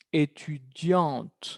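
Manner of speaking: "étudiante" very slow with a clear final T; final T sounded. Shown as a demonstration. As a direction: draw out "étudiante" and release the T. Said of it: "con sonido".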